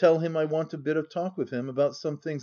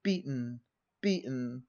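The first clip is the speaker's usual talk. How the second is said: murmured; 2 semitones lower, 7 dB quieter than usual. A narrator's reading. Hargrave to the beaten player, pressing the hand, murmuring. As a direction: murmur it softly, close to the ear.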